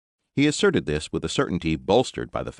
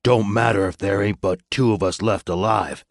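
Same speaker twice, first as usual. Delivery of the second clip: louder menacing biker growl; 4 semitones higher, 4 dB louder than usual. A narrator's reading. Joe the Blade threatening the old sergeant, growling. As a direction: threaten through your teeth. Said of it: "growled Joe the Blade".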